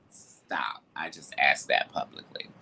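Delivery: unvoiced whispered aside. Real, not acted. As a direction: drop to a whisper. Whispered aside after plea.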